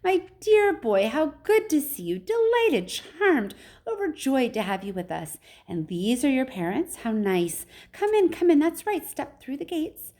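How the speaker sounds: high, gushing greeting; excitable, high-pitched storybook host; excited welcome. A narrator's reading. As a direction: gush over a guest in a high voice. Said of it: "clearly just excited as everybody else".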